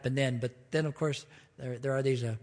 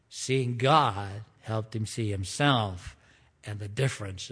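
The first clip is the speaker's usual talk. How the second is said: usual speaking voice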